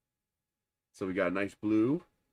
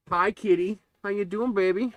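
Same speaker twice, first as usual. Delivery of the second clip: talking to a cat; 10 semitones higher, 4 dB louder than usual. Real, not acted. Greeting the cat.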